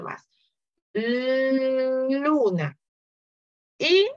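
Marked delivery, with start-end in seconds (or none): 0.9-2.8 "Luna" with the L held long; stretched L in luna. Shown as a demonstration. Teacher to pupils, luna with a long L. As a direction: draw out the L of "Luna". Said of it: "enfatizar un poco más el sonido de la L".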